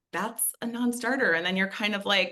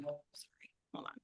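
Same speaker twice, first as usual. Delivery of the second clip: whispered aside; 4 semitones lower, 19 dB quieter than usual. Real, not acted. Flustered aside during tech trouble.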